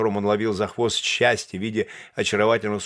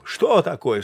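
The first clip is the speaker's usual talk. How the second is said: startled cry; 7 semitones higher, 3 dB louder, slower than usual. A narrator's reading. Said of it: "вскричал он с просонок".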